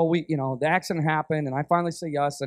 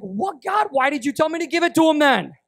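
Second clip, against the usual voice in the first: much higher, louder disbelief at God; bewildered outcry; a replayed shocked protest to God; 8 semitones higher, 4 dB louder than usual. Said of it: "I'm like".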